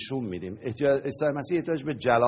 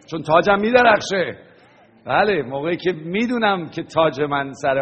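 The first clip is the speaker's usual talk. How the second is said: louder and higher, rising to a peak; excited; 4 semitones higher, 7 dB louder than usual. Real, not acted. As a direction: lift the line up with joy. Preacher exults about shining crown. (با شوق و صدای بلند)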